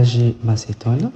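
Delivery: ordinary delivery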